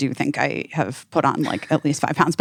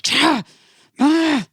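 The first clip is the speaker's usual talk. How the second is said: short, high exasperated yelps; frustrated outburst; 9 semitones higher, 3 dB louder than usual. Retold or imitated. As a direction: let out two mock growls of frustration. Frustrated by a ringing phone.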